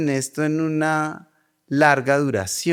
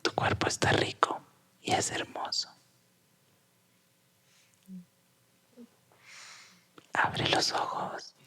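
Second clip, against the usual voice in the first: slow whispered guided meditation; right in your ear; 5 semitones higher, 11 dB quieter, slower than usual. Acted. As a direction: whisper close, slow and soothing. Whispered guided exercise, eyes closed.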